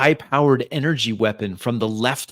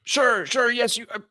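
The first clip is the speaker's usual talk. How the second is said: high, flustered voice; hastily agreeing old man; 12 semitones higher than usual. Acted. Old man accepting ransom terms, flustered agreement. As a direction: agree anxiously, stumbling.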